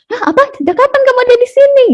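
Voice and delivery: high, quick burst of surprise; startled husband. Acted. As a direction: act suddenly noticing someone beside you. Distracted husband suddenly notices speaker. (kaget, nada tinggi dan cepat)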